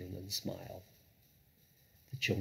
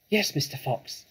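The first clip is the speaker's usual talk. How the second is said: higher, eager youngster voice; small badger; 5 semitones higher, 4 dB louder than usual. A narrator's reading. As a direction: answer up like a keen little one. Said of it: "said the small badger".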